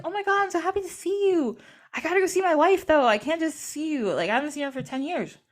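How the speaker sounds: a touch of giddy excitement; eager greeting. Retold or imitated. Osiris answers Set, eager for wife.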